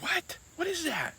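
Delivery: high-pitched imitation of a startled question; surprised. Speaker retelling Allie's reaction at campground, mock surprised. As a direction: mimic someone taken aback, voice jumping way up.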